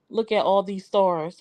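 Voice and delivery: ordinary talk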